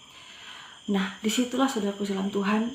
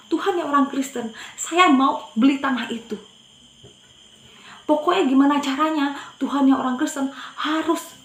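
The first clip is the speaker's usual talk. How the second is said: loud, higher, emphatic insistence; retold defiant demand; 6 semitones higher, 6 dB louder than usual. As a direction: press the demand on God forcefully. Retold challenge to God, pointing at land.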